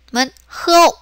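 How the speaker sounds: usual speaking voice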